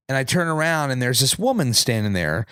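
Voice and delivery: ordinary talk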